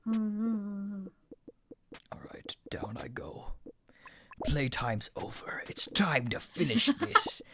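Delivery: breathy villain whisper, slow and menacing; anime villain. Pretend. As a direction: hush it like a gloating anime villain. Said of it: "talk like an anime villain".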